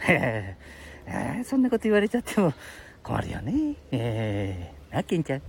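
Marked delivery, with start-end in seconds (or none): none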